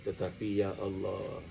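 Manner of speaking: soft, low, devout prayer voice; quiet prayer. Shown as a demonstration. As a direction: pray humbly under the breath. Models a husband's prayer. (doa lirih penuh khusyuk)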